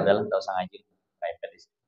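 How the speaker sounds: a retold wife, hushed and low, urging her husband to skip study; wife's discouraging quote. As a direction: drop the voice low and soft as a coaxing wife. Wife voiced telling husband to skip.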